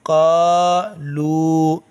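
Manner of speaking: का लू held very long; slow recitation drill; drawn-out vowels. Shown as a demonstration. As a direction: stretch the vowels of का लू slowly. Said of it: "लाम वाव पेश लू".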